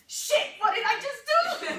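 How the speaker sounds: high, loud panicked outburst; freaking out. Retold or imitated. New coach calls her upline after quitting, freaking out. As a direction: blurt it out in a hammed-up panic. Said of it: "I am freaking out".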